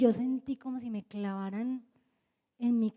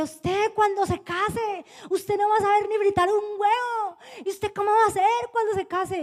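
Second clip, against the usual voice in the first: scolding mother; 6 semitones higher than usual. Retold or imitated. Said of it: "Y yo decía".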